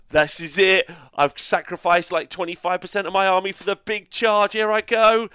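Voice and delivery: mock hyped player. Mocking a Kragnos player's big charge, hammed-up hype. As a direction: parody the player's all-in charge bravado. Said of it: "You're like".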